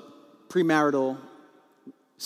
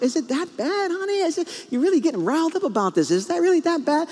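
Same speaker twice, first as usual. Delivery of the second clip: high-pitched incredulous playing-down; hammed-up "surely it's nothing" husband, pitched way up; minimizing; 12 semitones higher than usual. Husband playing down his wife's upset, mock minimizing. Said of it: "minimizing mode".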